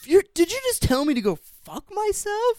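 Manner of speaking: hammed-up outraged yell; indignant outrage. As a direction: act like he can't believe the insult. Acting out the indignant villain.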